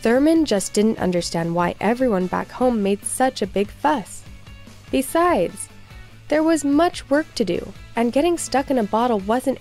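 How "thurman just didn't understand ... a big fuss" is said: plain reading voice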